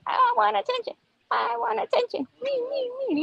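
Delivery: high, whiny plea for attention; needy fish voice. Pretend. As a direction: beg like a greedy little fish. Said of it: "Attention mode".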